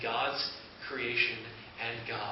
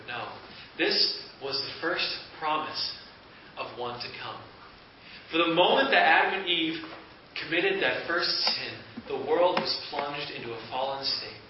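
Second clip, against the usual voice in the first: ordinary talk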